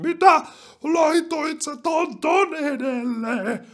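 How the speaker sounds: very high, drawn-out mock squawk; mock outraged commenter. People yelling about a top ten list, mock outrage. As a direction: exaggerate the outrage, dragging the words out in falsetto. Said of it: "porukka vois huutaa".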